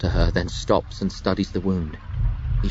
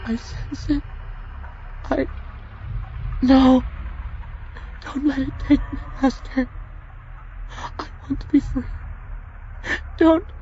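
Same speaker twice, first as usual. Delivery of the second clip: high, frightened dying plea; terrified Junie. Acted. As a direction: beg him not to let go.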